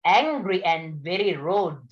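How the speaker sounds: ordinary talk, nothing marked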